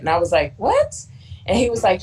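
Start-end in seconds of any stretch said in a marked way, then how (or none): 0.6-0.9 high, stunned disbelieving what; shocked what. Retold or imitated. Retelling her reaction to the advisor's insult, high and stunned.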